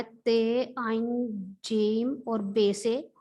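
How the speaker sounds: spelling ताज्जुब out slowly; slow classroom spelling. Shown as a demonstration. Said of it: "तो इसको sir बच्चे पढ़ें ताज्जुब".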